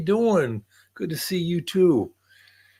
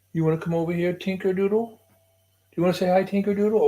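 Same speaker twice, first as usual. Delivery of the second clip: higher, sing-song calling to the dog; high coaxing pet voice; 6 semitones higher than usual. Real, not acted. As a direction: coax the shy dog over sweetly. Coaxing dog to come over.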